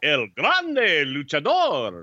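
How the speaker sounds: very slow booming announcer call, rolling the R's; ring announcer with rolled R's. Acted. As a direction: roll every R and draw the name out for the crowd. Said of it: "you were, like, rolling R's and shit".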